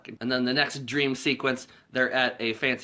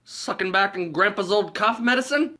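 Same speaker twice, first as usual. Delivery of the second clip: goofy cop; 7 semitones higher, 5 dB louder than usual. Retold or imitated. Cop pulling over the boys, hammed-up goofy cop. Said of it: "it's Harland Williams".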